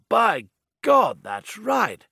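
high, loud eager man; excited exclamation. Acted. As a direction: burst out, delighted. Excited man voiced in car.

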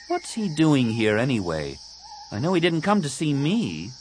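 a touch of pique, rising in pitch; suspicious Tahiri. Acted. Tahiri eyes her friend's arrival, mildly suspicious.

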